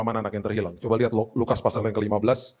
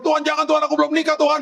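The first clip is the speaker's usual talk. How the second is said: very high, pleading cry to God; mock panicked outburst in prayer; imitated shout; 14 semitones higher, 3 dB louder, faster than usual. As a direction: cry out like an alarmed congregant. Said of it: "tiba-tiba ada yang teriak".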